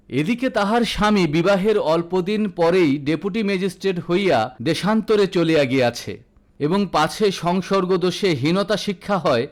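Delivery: usual speaking voice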